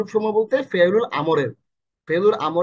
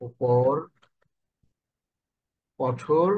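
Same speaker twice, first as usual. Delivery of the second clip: very slow; 5 semitones lower, 4 dB quieter, much slower than usual. Shown as a demonstration. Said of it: "তুমি এতিমের উপর কঠোর হইও না".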